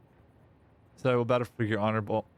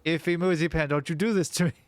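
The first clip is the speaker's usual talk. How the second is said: louder and higher, full name called out; mock despair; higher pleading cry; 6 semitones higher, 4 dB louder than usual. Real, not acted. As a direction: plead with the friend, half joking.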